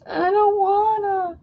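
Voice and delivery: put-on very high, slow whining child; whiny kid. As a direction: whine to mom like a kid who won't get up. Mimicking whiny kid to mom.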